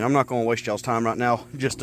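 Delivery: ordinary delivery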